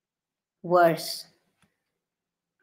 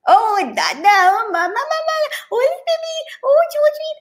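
very high gushing delight; jubilant parents. Retold or imitated. Parents celebrating every baby word, very high gushing. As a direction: gush over every word.

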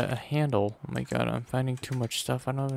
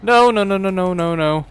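panicked scream; 9 semitones higher, 12 dB louder than usual. Real, not acted. Jump scare by enemies.

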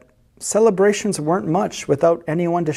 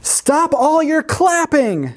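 high, drawn-out tantrum cry; high-pitched indignant wail; angry cry; 11 semitones higher, slower than usual. A narrator's reading. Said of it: "he cried".

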